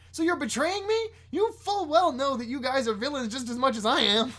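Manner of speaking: very high, indignant character voice; betrayed villain. Acted. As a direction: squawk the accusation in mock outrage. Villain feels betrayed by bankers.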